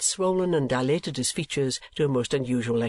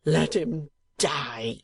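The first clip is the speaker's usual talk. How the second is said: wrathful cry; much slower than usual. A narrator's reading. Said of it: "cried Mister Kenwigs in the torrent of his wrath".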